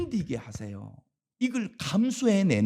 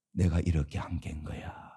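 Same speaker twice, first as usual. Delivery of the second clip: whisper; 9 semitones lower, 8 dB quieter than usual. Acted. God's voice, whispered in sermon.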